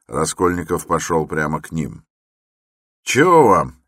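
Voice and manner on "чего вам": high, loud gruff reply; porter answering. A narrator's reading. Porter answers a stranger at the gate, brusque and loud. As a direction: answer sharply, raised voice. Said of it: "отозвался один из дворников".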